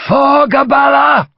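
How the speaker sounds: high, slow, roaring Irish battle cry; yelled battle cry. Acted. Irish major launching the charge, full yell. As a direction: let the war cry ring out. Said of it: "Major Goff yelled".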